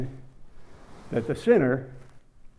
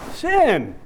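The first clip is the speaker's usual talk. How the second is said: emphatic answer; 6 semitones higher, 5 dB louder than usual. Real, not acted. Congregation fill-in answer.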